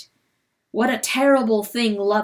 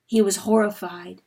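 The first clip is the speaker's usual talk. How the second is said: usual speaking voice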